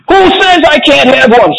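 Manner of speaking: put-on very high-pitched indignant child; Veruca shouting. A narrator's reading. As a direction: shriek back in bratty defiance. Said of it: "shouted Veruca".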